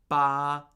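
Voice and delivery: "ba" held on one even pitch; high level tone. Shown as a demonstration. Teacher shows four different Mandarin words, high level tone. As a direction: keep "ba" high and steady, no slide. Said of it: "those are four completely different words".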